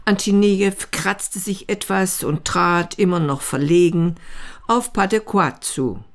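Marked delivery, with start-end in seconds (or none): none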